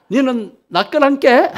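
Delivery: high, loud, slow Jeolla accent; Jeolla dialect. Shown as a demonstration. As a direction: ham up a Jeolla version, about to laugh. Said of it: "전라도 버전은요".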